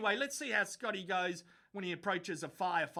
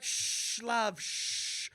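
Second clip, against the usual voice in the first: mock hushing; 2 semitones higher, much slower than usual. Mocking the Prime Minister hushing the woman, whispered shush. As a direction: shush her like a patronising PM. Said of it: "He's like".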